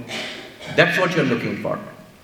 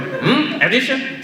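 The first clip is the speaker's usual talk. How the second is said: a high, doubtful "Hmm? Addition?"; skeptical grandfather; 7 semitones higher, slower than usual. Retold or imitated. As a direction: question it like a strict old teacher.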